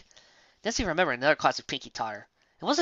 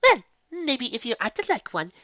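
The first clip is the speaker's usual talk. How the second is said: higher, lady's cool comeback; higher refined retort; cool counter; 10 semitones higher than usual. A narrator's reading. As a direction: slip the barb in sweetly. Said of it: "Vanity countered".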